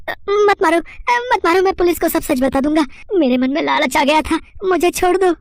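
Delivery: very high, panicked begging; terrified pleading. Acted. Thief begging Hanuman to stop hitting, high-pitched terror. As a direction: plead for mercy in a high squeal.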